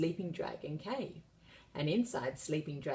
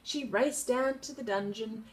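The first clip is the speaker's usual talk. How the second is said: ordinary delivery, nothing marked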